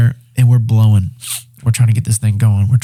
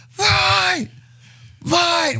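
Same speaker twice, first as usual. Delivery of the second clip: very high chanted yell, one word at a time; screaming fight; 5 dB quieter than usual. Retold or imitated. Two teammates screaming at the fire to win. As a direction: scream it like a war cry. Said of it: "We're just screaming".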